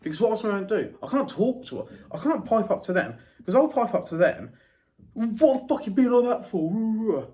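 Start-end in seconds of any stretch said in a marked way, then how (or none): 5.1-7.3 sharp, slow confrontational challenge; angry. Retold or imitated. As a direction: confront them angrily, higher and louder. Quoted confrontation with coworkers.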